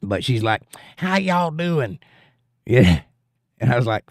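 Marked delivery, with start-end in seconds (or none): 1.0-2.0 slow, drawn-out fake Southern accent, pitched up; put-on Southern accent. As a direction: mimic a waitress faking a Southern accent. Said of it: "totally put on Southern accent".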